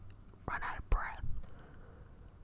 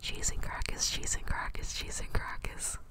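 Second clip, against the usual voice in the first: ASMR trigger words; 10 dB louder than usual. Shown as a demonstration. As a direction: repeat it gently like a trigger word. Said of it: "repeat nice sounding words".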